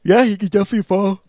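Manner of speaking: words stretched by a yawn. Real, not acted. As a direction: talk through a real yawn. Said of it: "Whew, sorry".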